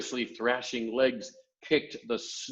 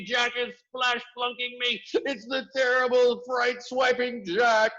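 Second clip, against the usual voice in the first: terrified bellowing; 11 semitones higher, 5 dB louder than usual. A narrator's reading. Giant wailing in terror of Jack. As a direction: shriek louder and higher with every line. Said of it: "bellowed the flesh lump eater".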